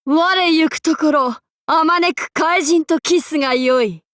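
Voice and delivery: ordinary talk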